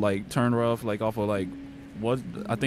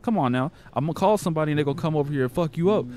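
normal talking voice

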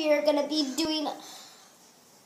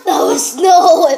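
loud reaction; 3 semitones higher, 13 dB louder, slower than usual. Real, not acted.

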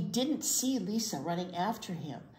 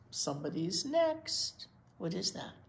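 ordinary delivery, nothing marked

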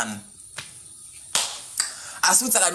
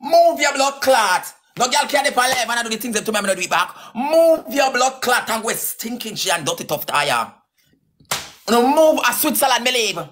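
angry cursing; 3 dB louder than usual. Real, not acted. Telling a girl to move and go, angry. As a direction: let the curses fly, hard and forceful.

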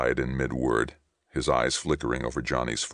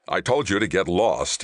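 raised, higher snarl; angry snarl; 5 semitones higher, 4 dB louder than usual. A narrator's reading. Ray turns on Johnny on the bridge, snarling. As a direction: snarl it to drive him away. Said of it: "he snarled".

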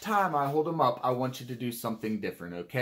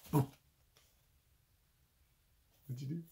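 usual speaking voice

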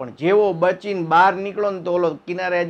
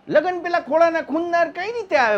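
astonished question; 12 semitones higher, 3 dB louder than usual. Retold or imitated. Teller's astonished question to girl.

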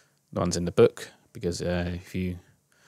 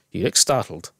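ordinary delivery, nothing marked

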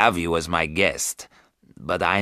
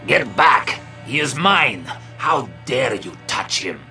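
a possessive vampire lord shouting at the women; angry cry. A narrator's reading. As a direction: snap them away from his prey. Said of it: "he cried".

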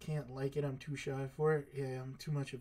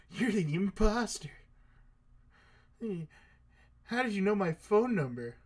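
startled accusation; 5 semitones higher, 5 dB louder than usual. Real, not acted. Confronts the imposter on phone.

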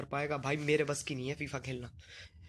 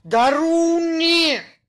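high, slow, loud denial; mock shout; 13 semitones higher, 14 dB louder, much slower than usual. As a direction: yell it playfully. Joking denial. (ऊँची, खिंची हुई मज़ाकिया चीख)

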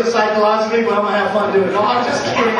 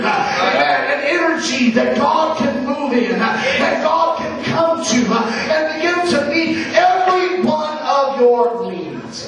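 rhythmic preaching, building higher and slower; fervent preaching; 5 semitones higher, slower than usual. Real, not acted. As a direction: drive each phrase home with a punctuating "uh".

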